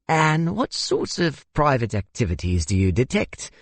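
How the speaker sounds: high, theatrical South African flourish; theatrical questioning. A narrator's reading. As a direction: ask with an air of theater. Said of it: "said with an air of theater".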